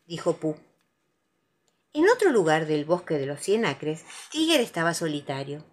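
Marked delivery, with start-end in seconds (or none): none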